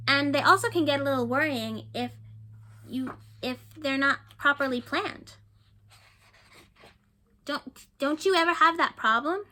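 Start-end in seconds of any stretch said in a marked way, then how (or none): none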